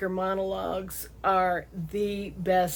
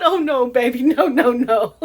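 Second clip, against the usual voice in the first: laughing denial; 6 semitones higher, 9 dB louder than usual. Real, not acted.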